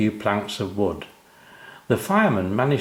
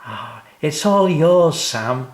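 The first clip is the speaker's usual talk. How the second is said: high, louder, slow proud handyman; 7 semitones higher, 5 dB louder, slower than usual. Acted. O'Grady gives Sam the tree house, proud and slow. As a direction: present the gift with pride. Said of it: "in a proud voice".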